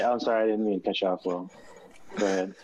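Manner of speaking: fast mocking apology. Shown as a demonstration. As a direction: rattle it off teasingly. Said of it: "I was making fun of Will".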